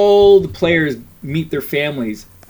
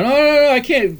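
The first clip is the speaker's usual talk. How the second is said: flustered protest; 10 semitones higher than usual. Retold or imitated. Refusing friend's seat upgrade.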